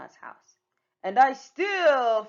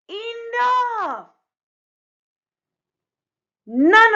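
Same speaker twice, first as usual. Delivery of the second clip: much higher, loud outburst; the fed-up storyteller stopping the chaos; exasperated shout; 13 semitones higher, 12 dB louder, much slower than usual. Acted. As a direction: snap and end it all at once. Storyteller loses patience.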